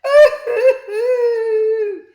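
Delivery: very high, loud, slow wailing boo-hoo; hammed-up howling sob. A narrator's reading. Shop twins howling in despair on their knees, mock wailing. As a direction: wail like a broken man, hammed up. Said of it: "howled in despair".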